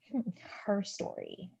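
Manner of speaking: very soft, whispered disbelief; stunned whisper. Acted. Reluctant newcomer told they are the author, whispering. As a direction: whisper it to yourself, taken aback.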